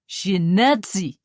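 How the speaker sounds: put-on Russian officer pronouncing judgment on the old woman; pronounced Russian accent. A narrator's reading. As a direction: drag it out as a final verdict. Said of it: "his accent very pronounced".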